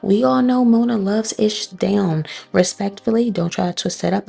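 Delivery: ordinary delivery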